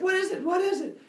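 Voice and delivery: high, loud, frantic questioning; acted panic. Zeus asking about his poked brain, frantic and high.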